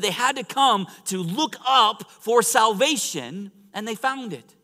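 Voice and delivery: high, loud, emphatic sermon delivery; full-force preaching. Real, not acted. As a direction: drive it home to the congregation.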